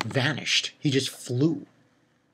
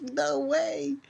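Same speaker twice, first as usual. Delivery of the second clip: surprised; 12 semitones higher, 4 dB quieter than usual. Retold or imitated. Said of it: "I was like".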